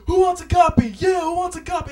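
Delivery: high, loud crowd-pumping shout; hype shout. Retold or imitated. Tyrese pumping up the crowd, loud hype shout. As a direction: work the crowd like a showman. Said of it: "he's like".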